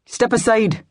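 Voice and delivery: commanding aristocratic burr; aristocratic Scottish burr. A narrator's reading. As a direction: order the crowd aside with authority. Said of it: "a deep, aristocratic burr".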